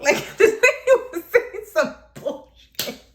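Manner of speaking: high, loud, slow words cracking up; laughing. Real, not acted. Storyteller cracks up at what this lady said, laughing incredulously. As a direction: laugh helplessly through the words. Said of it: "I'm laughing".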